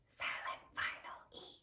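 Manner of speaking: hushed teacher's whisper; very soft, voiceless whisper. Shown as a demonstration. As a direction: whisper it, as for a silent letter. Teacher voices the silent letter.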